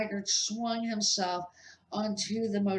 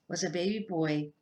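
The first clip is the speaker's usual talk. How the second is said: ordinary delivery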